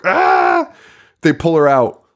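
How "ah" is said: put-on very high, louder scream of a girl in the water; a retold swimmer's shriek; mock scream. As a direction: shriek like something grabbed you while swimming. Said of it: "Reverend's daughter is swimming and is like".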